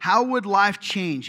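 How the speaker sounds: emphatic question. Real, not acted. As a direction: lean hard into the question.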